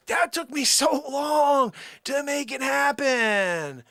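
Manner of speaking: high, loud wailing complaint; hammed-up screaming despair, stretched out at the end; furious tirade. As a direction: shriek in outrage over wasted work. Tirade at God continues.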